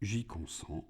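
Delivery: hushed divine calm; God's voice. A narrator's reading. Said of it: "L'être dit".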